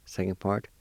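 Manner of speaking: ordinary talk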